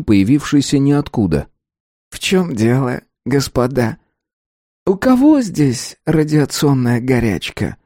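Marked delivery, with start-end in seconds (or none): 2.1-7.8 silky, ingratiating intruder; insinuating little man. A narrator's reading. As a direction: slide in smoothly, drawing out the question. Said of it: "с вкрадчивым голосом".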